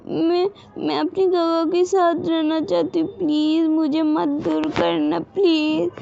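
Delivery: put-on high, tearful little girl's begging; crying child. A narrator's reading. Little Wing begs the stranger mom not to separate her, high and crying. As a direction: plead through tears not to be taken away. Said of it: "wing रोने लग जाती फिर से".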